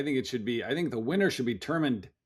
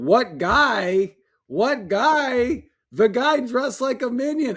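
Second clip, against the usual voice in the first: imitating a buddy's disbelief, higher and rising; mock incredulous; 8 semitones higher, 4 dB louder, much slower than usual. Buddy mocking him for missing the guy, incredulous. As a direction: throw the question back in amused disbelief. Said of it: "lost his mind laughing".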